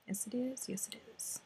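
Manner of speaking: unvoiced whisper to herself. Real, not acted. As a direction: breathe it out, no voice. Whispered to self.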